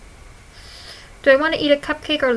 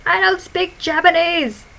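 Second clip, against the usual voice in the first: mock scream; 6 semitones higher, 4 dB louder, slower than usual. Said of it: "Me screaming at my computer".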